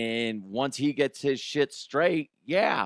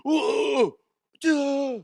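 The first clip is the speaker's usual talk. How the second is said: high-pitched mocking wail; mock whining; 13 semitones higher, 4 dB louder than usual. Mocking a player complaining on court, mock whining. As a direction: whine it like a big crybaby. Mimics Jokic whining to refs.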